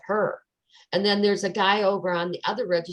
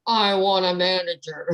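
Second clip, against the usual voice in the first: firm, slightly raised demand, lightly acted; indignant demand; 4 dB louder than usual. Customer demanding a manager from the cashier, with mock indignation. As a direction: retell your huffy request to the clerk.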